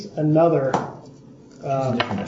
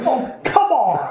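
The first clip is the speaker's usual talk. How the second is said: higher, slow, laughing protest; exasperated brigand; 7 semitones higher, slower than usual. Acted. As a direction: whine it like a fed-up henchman. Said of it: "He's like".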